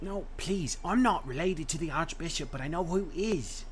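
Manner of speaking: high, begging prisoner; pleading. Acted. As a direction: plead for your life. Captured rogue begs.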